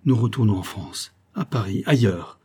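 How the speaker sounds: usual speaking voice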